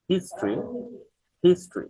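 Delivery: হিস্ট্রি as "HIS-tri", no middle o; হিস্ট্রি in two syllables; model pronunciation. Shown as a demonstration. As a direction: drill হিস্ট্রি high then falling. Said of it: "এটা হিস্টোরি আমরা বলবো না".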